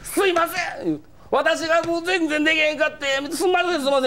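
high, teary mock apology; mimicking a tearful apology. Manager apologising to the comedian, mock tearful. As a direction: imitate the near-crying manager, pitched high. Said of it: "もう泣きそうなんですよ、女の子".

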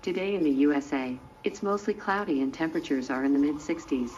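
American-accented weather report. Shown as a demonstration. Robot asked for New York weather, in an American accent. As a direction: give the forecast in an American accent. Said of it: "Tell me in your American accent".

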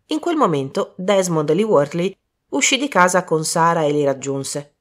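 ordinary delivery

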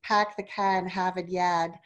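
broad Boston vowels, no R in "car" or "yad"; Boston accent. Shown as a demonstration. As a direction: demonstrate a Boston accent with dropped Rs. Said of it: "what the Boston accent sounds like".

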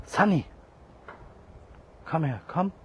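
high, loud call to a cat across the yard; loud, high call for the kitten. Real, not acted. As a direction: shout the cat's name so it comes. Calling cat from afar.

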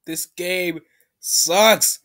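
very slow, heavily weighted words; exasperated complaint. Real, not acted. Gamer fed up with the game, slow exasperated complaint. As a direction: drag each word out in real disgust.

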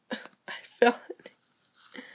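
slow, whispered admission; defeated whisper. Real, not acted. Gamer whispering after falling into a pit. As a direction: breathe it out, beaten. Just fell in.

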